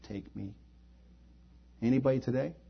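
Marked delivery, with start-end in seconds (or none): 0.0-0.6 quiet surrender prayer. Pretend. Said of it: "and say".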